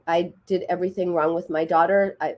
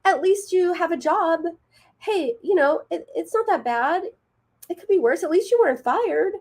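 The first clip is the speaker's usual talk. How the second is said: high-pitched, chirpy consoling; dismissive positivity; 10 semitones higher than usual. Acted. Someone minimizing your terrible day at work, chirpily dismissive. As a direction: brush off bad news in a high, breezy voice. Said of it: "and someone tells you".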